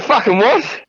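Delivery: high, incredulous what; can't believe his ears; repeating in shock, pitch up; disbelieving repeat. Retold or imitated. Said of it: "I said".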